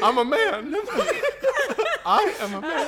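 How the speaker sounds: high laughing whimper; hammed-up pleading whimper. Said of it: "The whimpering voice".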